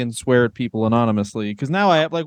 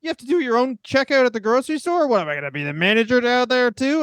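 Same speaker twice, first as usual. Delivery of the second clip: cheesy comedian bit; 6 semitones higher than usual. Pretend. Mock stand-up bit.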